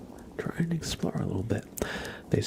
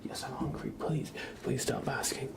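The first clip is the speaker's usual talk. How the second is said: soft, higher voice for the cat; pleading cat's voice; 5 semitones higher, 7 dB quieter than usual. Acted. Cat begging owner to stop asking, softly pleading. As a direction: plead quietly as the pestered pet. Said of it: "He's like".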